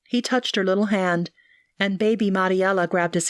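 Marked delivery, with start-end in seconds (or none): none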